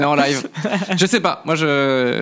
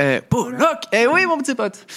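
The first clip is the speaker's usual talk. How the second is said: playful hail; 10 semitones higher, slower than usual. Acted. Teasing greeting to Pollock.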